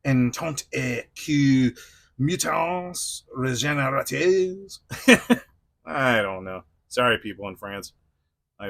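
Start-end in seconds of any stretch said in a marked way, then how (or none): 0.1-4.8 slow, badly faked French pronunciation; terrible French. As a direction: stumble slowly through mock French, drawing it out. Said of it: "That's a terrible French".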